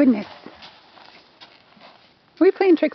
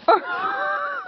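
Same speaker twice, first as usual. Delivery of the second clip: squealing laugh; 11 semitones higher, 5 dB quieter than usual. Real, not acted. Laughing at horse.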